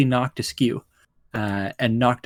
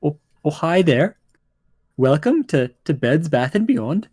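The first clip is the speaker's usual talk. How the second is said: higher-pitched, lilting gnome greeting; small gnome; 4 semitones higher than usual. Acted. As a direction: greet them as a hesitant little gnome. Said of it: "you see a small gnome".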